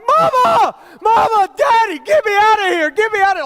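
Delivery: put-on high, loud terrified child screaming; panicked screaming. Five-year-old trapped under the bed screams for his parents in panic. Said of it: "I start screaming bloody murder".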